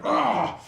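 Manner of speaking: loud, high shriek of shock; startled high howl; doused yelp. Acted. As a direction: cry out as cold water hits. Gnoll doused with bucket of water.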